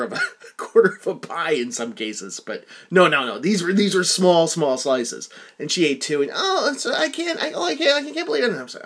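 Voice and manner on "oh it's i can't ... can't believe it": high, fussy imitation of his mother; mother's fretting voice. Son imitating his mother's guilty fuss over pizza, pitched high. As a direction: play her up high, dithering over the slices.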